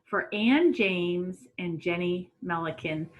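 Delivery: ordinary talk, nothing marked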